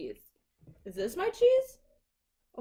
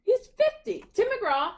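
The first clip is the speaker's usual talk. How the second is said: indignant exclaim; 12 semitones higher, 8 dB louder than usual. Real, not acted. Insists on McGraw's age.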